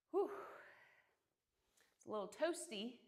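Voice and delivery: usual speaking voice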